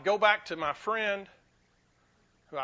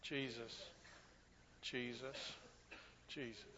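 much softer, lower, each name fading; hushed reverent repetition; 8 semitones lower, 17 dB quieter than usual. Shown as a demonstration. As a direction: breathe the name softly, again and again. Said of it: "Keep saying it".

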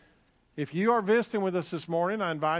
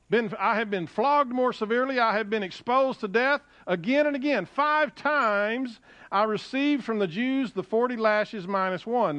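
scripture reading; 5 semitones higher than usual. Acted. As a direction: proclaim each hardship to the congregation. Reads scripture aloud to congregation.

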